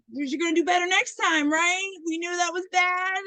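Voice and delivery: high, strained mock politeness; pained restraint, imitated. Coach trying not to scream at a player, pained restraint. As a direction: act out holding back a scream. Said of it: "you could tell it hurt him so bad".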